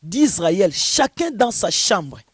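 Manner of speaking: loud, declaiming sermon voice, slowing toward the end; forceful preaching. Acted. Preacher opening his message to the host, loud and declaiming. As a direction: proclaim it like a preacher from the pulpit.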